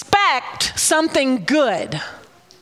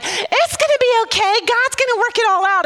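much higher, bright mock cheer; perky optimist; 12 semitones higher, faster than usual. Friend brushing off her upset, mock perky cheer. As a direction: ham up a sugary cheerful friend. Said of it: "It's not perky".

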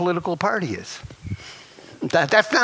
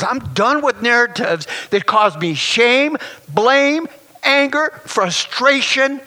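louder and higher on each word, the last one slow; preaching build; 5 semitones higher, 4 dB louder, slower than usual. Real, not acted. Pastor rejecting shameful narratives before the church, building fervent cry. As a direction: climb to a fervent cry of release.

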